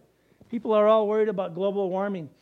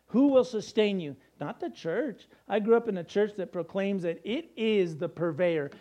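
ordinary delivery

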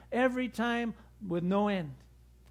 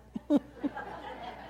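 soft unvoiced chuckle, higher; breathy laugh; 7 semitones higher, 3 dB quieter than usual. Real, not acted. Preacher chuckles at his bad joke, breathy.

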